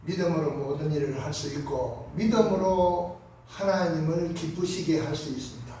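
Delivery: normal talking voice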